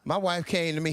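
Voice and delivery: ordinary delivery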